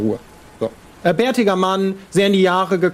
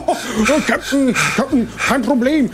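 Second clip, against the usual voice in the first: lightly voiced, a bit higher, friendly old man with a pipe; friendly old sailor; 3 semitones higher than usual. Old pipe-smoking sailor calms down the angry captain, friendly and grinning. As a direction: soothe the captain with a grinning ease. Freundlich grinsend.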